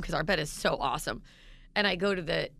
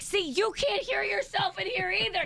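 much higher, straining to be heard; shouting over noise; 12 semitones higher than usual. Retold or imitated. Shouting over surround sound.

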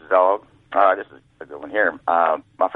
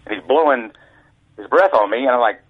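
normal talking voice

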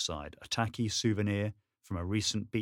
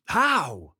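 shocked; 8 semitones higher, 9 dB louder than usual. Acted. Shocked follow-up question.

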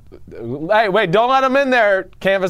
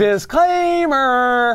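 higher, slow drawn-out announcement; announcer voice; 7 semitones higher, much slower than usual. Pretend. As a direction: stretch it out like a warning jingle. Mock announcement repeated.

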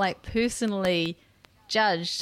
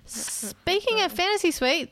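teasing announcement; 10 semitones higher, slower than usual. Real, not acted. Dramatic segue into news.

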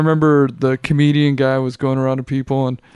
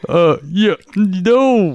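ordinary talk, nothing marked

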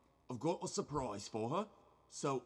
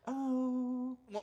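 put-on high sweet girl voice; little girl voice; 10 semitones higher than usual. [name] playing ghost girl Cece.

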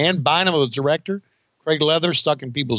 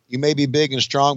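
normal talking voice

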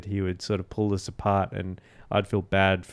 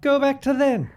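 much higher, fast hammy villain voice; bad movie reenactment; 4 dB louder than usual. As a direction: ham up the frantic space villain. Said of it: "that was a bad reenactment of the movie".

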